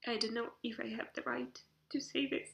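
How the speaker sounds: tearful, voice breaking; choked up and halting; crying. Real, not acted. Crying while addressing niece.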